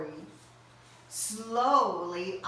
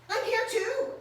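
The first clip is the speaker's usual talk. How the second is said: high cry; 13 semitones higher than usual. Acted. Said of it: "Owl cried".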